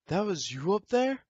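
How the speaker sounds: amazed question. Retold or imitated. Peers amazed.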